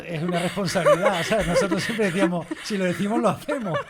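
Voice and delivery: talking through laughter; laughing. Real, not acted. Guest laughing while quoting their motto. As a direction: laugh as you tell it.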